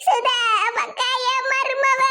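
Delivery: slow, sobbing wail; crying. Acted. Grandmother breaking news of her missing daughter-in-law, crying. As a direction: drag the words out through tears. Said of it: "இப்படி அழுதுகிட்டு இருக்கிய".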